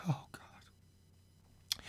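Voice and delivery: very soft, breath-only prayer; frightened whisper. A narrator's reading. Husband lost in the dark room, terrified whisper. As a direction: whisper it under your breath in dread.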